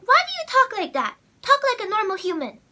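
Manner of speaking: high, loud retold reprimand; scolding teacher imitation. Sixth grade teacher telling her to talk normally, scolding. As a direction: snap it like an exasperated teacher. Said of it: "She said".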